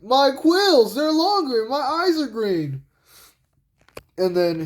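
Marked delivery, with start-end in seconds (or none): 0.0-2.8 high, drawn-out mock alarm; Sonic. Sonic discovering his transformation, high cartoon astonishment. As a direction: exclaim high, slowing on the last words. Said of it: "he goes like".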